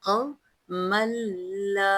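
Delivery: slow chanted verse with long vowels; tajweed recitation. Shown as a demonstration. Teacher reciting the verse for students, slow melodic chant. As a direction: chant each word slowly and melodiously. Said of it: "कैसे पढ़ना है?".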